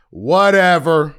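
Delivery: loud, high, long-drawn dismissal; exaggerated, drawn-out brush-off; dismissive. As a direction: wave him off with a big stretched word. Dismissing the man in retold exchange.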